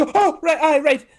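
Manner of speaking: high, flustered yes-yes. Retold or imitated. Trainee told to fetch the mother, high flustered agreement. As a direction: panicky agreement as she rushes off.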